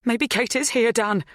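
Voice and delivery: high, urgent hope. A narrator's reading. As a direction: press the point urgently. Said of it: "she said urgently".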